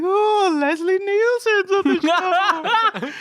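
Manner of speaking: high wailing, slow; Paul Bearer impression. Host mocking Paul Bearer, high slow wail. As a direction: wail it high like an excited manager. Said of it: "Paul Bearer's like".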